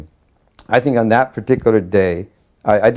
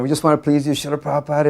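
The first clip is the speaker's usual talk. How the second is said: higher-pitched, mildly frantic insistence; retold anxious pleading; 6 semitones higher, faster than usual. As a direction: plead eagerly, as if replaying your nervous babble. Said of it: "I just kept saying over and over".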